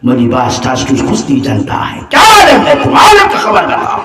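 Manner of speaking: Hindi quote that climbs to a high shout; quoting the Hindi-speaking master. Retold or imitated. The master demands the man tell the news of the world, in a rising shout. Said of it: "Miran Shah হুজুর বলেন".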